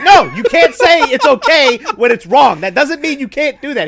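loud, high retold scolding. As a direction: scold a coworker, loud and exasperated. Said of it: "I'm like".

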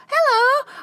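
high, loud friendly "Hello"; a cheerful hello read from eyebrows above a mask; bright greeting. Shown as a demonstration. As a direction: greet someone with your eyebrows. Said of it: "you can read".

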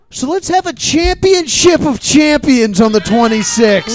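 big, punched event announcement; announcer proclamation. Real, not acted. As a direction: proclaim it like a grand title fight.

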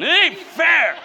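put-on high, loud childish whine; mock whine. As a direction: whine it like a spoiled kid. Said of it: "And I was whining".

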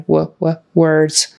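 w-, w-, words with lips pushed in; W sound drill. Shown as a demonstration. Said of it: "your mouth goes in".

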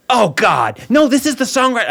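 hammed-up frustrated host; exasperated organizer. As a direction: act out his despair. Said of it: "And he goes".

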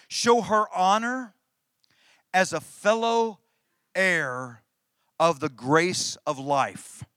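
slow, emphatic verse with weighty pauses; loud, deliberate preacher's quotation; scripture reading. Real, not acted. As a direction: read it out phrase by phrase, pressing each.

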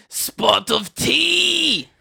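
high, loud, long-drawn mock yell; hammed-up British battle cry, last word stretched; yell into void. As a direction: holler it slowly out into the void. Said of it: "continues to yell out to the void".

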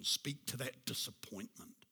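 whispered, intense urging; hushed sermon whisper. Real, not acted. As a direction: breathe the words with conviction. Preacher drops to a whisper.

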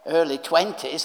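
ordinary talk

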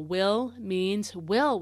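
"will" drawn out slowly at both ends; slow, deliberate stress on "will"; emphasis on will. Shown as a demonstration. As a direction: stretch and weight each "will". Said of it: "extra emphasis on the word will".

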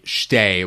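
"shtei" with sh and t joined; one-syllable shtei. Shown as a demonstration. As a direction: collapse "shtei" into a single syllable. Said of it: "with one syllable".

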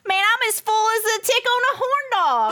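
big hillbilly twang. Acted. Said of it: "when I hear that accent".

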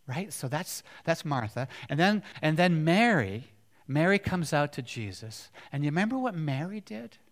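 usual speaking voice

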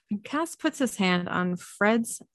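plain reading voice

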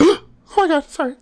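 high-pitched mock startled apology. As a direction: jump in fright, voice shooting way up.

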